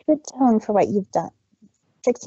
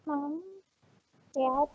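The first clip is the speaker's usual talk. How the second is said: put-on very soft, hesitant child voice; timid call; 4 semitones higher, 11 dB quieter than usual. A narrator's reading. Scared filly softly getting her parents' attention. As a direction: call to them nervously.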